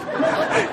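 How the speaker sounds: high, breathy giggle; high giggle at his own story; burst of laughter. Real, not acted. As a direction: laugh at the memory.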